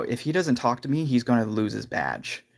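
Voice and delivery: normal talking voice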